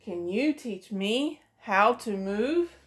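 ordinary delivery, nothing marked

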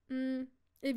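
ordinary delivery, nothing marked

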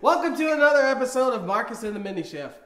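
loud, high announcer greeting; show host intro. Acted. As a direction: welcome viewers like a TV host.

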